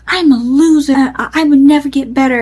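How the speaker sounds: whining imitation of someone crying; retold crying. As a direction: imitate a drunk man's tearful self-pity. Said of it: "and then he starts crying".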